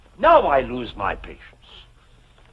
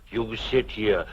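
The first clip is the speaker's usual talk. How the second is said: ordinary talk, nothing marked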